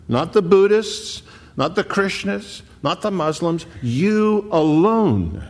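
rising preacher's fervor; preaching at full force. Acted. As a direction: drive the point home to the congregation. Preaching climax, raised voice.

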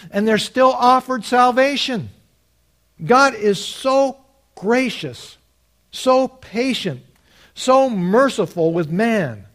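impassioned preacher at a peak; emphatic preaching. Real, not acted. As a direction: drive the point home to a congregation, pitch lifted.

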